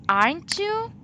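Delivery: "Aren't you" lifted high; exaggerated questioning rise on "Aren't you"; expressive rising tag. Shown as a demonstration. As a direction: swing "you" right up at the end. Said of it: "I express more like".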